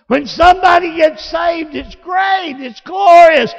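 high, loud, glorious shout; preaching shout. Real, not acted. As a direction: shout it to the rafters.

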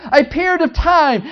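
ordinary talk

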